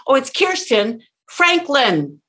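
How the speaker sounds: slow "Kirsten", break, punched "Franklin"; pause and punch. Acted. Listener recalling a name later, "Kirsten Franklin" slowly punched. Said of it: "they will repeat it the same way you said it".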